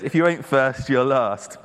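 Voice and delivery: loud, tentative American movie-motto delivery; American accent. Acted. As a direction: have a modest go at an American accent. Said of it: "how to say this in American accent".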